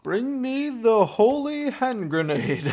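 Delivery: high, slow comic proclamation; hammed-up film quote; mock-solemn command. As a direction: intone it grandly, high and drawn out. Quoting Monty Python command.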